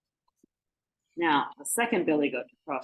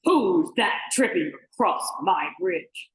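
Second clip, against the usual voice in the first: slow, loud troll challenge; booming storybook troll; roaring troll; 5 dB louder than usual. Acted. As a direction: roar it out slowly from under the bridge. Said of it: "roared the troll".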